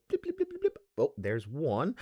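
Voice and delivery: high, soft imitated blips; measuring pour noise. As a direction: mimic a careful measured pour. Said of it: "they go".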